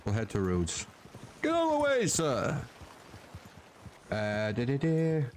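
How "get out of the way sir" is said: calling out. Real, not acted. Yelling at game character.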